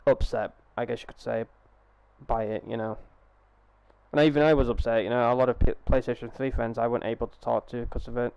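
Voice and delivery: usual speaking voice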